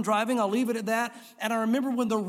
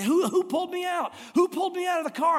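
much higher, urgent bewildered question; shaken driver; 8 semitones higher than usual. Retold or imitated. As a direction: ask like you just crawled from flames. Dazed driver after crash.

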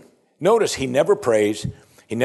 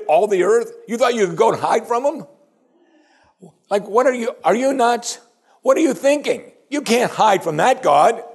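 high, loud disbelief, acted out; incredulous pagans; 11 semitones higher, 4 dB louder than usual. Pagans confront the man hiding from God, high-pitched incredulous outburst. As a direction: exclaim it as an appalled, astonished challenge. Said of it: "Their view is like".

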